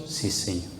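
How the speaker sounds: soft, low, submissive answer; small hushed reply; meek assent. Acted. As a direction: answer quietly and humbly, dropping low. Acts the believer's meek answer.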